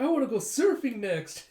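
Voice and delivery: put-on high, loud kid enthusiasm; bursting, high-pitched eagerness; excited child. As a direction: announce it eagerly like a little kid. Child eager for next activity.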